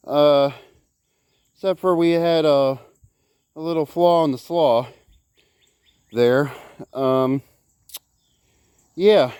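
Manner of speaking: breathless and panting; winded from the work; out of breath. Real, not acted. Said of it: "Sorry I'm out of breath".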